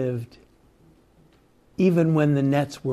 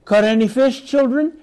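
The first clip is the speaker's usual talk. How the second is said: mock call; 8 semitones higher, 4 dB louder than usual. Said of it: "has the word paideia, children".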